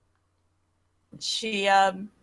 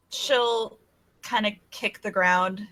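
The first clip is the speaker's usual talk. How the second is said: ordinary delivery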